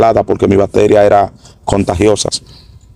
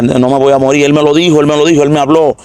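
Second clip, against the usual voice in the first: insistent excitement; 5 semitones higher than usual. Retold or imitated. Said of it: "desperté dando gritos locos".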